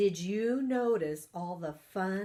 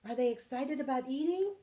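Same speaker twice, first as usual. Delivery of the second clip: ordinary delivery, nothing marked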